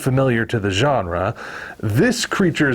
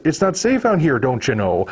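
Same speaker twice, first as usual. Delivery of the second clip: put-on Midwestern accent; 2 semitones higher than usual. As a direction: quote it like a nice lady from Minnesota. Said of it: "they decide to go with a Midwestern American accent".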